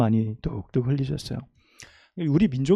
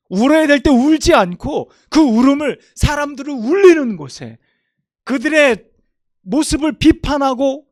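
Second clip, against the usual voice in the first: much higher, loud impassioned preaching; preaching at full force; 10 semitones higher, 7 dB louder than usual. Acted. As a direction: rebuke the generation with full force. Peak of sermon rebuke.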